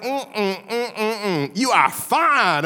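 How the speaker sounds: appreciative hums, then a drawn-out compliment. Retold or imitated. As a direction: imitate a jock ogling the girls. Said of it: "looks around at the girls and he's like".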